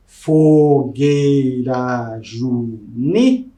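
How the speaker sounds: slow, each syllable spaced apart; syllable counting. Shown as a demonstration. Teacher checking the meter for her class, slow syllable by syllable. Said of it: "Vamos ver se bate".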